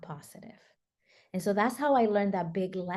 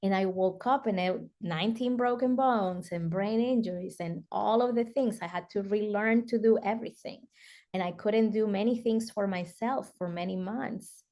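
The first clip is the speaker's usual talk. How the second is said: ordinary delivery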